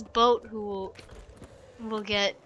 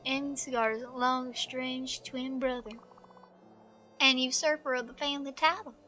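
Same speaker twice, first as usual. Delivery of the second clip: softer, measured storyteller; story narration; 8 dB quieter than usual. A narrator's reading. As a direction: let the tale unfold in hushed, even phrases. Reads game story aloud.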